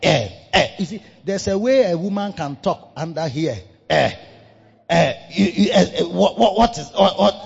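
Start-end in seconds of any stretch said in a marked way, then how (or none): 3.9-7.3 mock wife whimpering, stammering and louder; whiny crying voice. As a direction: stammer out a fake tearful complaint. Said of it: "It's like your voice cry".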